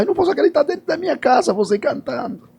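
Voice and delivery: high, fast, tearful-sounding amazement; a moved woman hearing her favorite singer at home; overwhelmed disbelief. Retold or imitated. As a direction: gush it out in disbelief. Woman crying as he sings.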